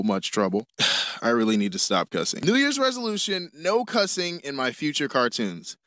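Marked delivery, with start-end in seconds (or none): none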